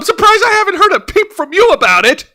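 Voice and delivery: high, loud teasing jab. Real, not acted. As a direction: tease him, voice shooting up. Teasing co-host.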